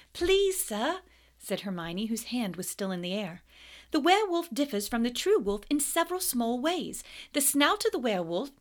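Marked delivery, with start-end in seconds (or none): 0.1-1.0 high eager plea; eager Hermione. A narrator's reading. Hermione begging to answer Snape, high eager voice. 3.9-8.5 high eager plea; eager Hermione. A narrator's reading. Hermione begging to answer Snape, high eager voice.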